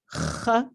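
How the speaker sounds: j as a strong rasping uvular H; frictioned j. Shown as a demonstration. Teacher explaining j as a frictioned H, demonstrated. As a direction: scrape the j at the uvula. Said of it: "a really frictioned H".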